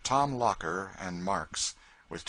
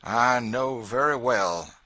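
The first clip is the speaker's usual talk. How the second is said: slow, louder, bitter; bitterly; 8 dB louder, slower than usual. A narrator's reading. George to the officer, bitter answer. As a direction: let the bitterness weigh on each word.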